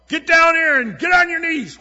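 high, loud barked order; a mock tyrant with a bullwhip, bellowing at sinners; harsh shouted command. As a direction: yell it like a whip-cracking tyrant. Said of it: "a bullwhip with the other".

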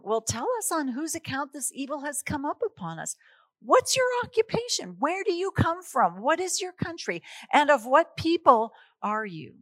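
rapid, high, urgent sailors' questions; frantic questioning. Acted. Frightened sailors interrogate Jonah, pitch rising high and fast. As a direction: fire questions at him in panic.

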